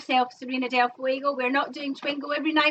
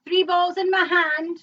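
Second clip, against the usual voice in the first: slow, loud caller announcement; bingo caller; 5 semitones higher, 5 dB louder than usual. Acted.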